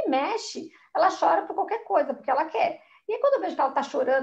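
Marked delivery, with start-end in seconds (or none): none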